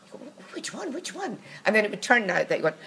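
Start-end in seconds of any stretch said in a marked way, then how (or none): 0.5-1.4 high, hushed mock eagerness; eager questioning. Colleague quizzing film extra, eager. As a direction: press for the answer, high and soft.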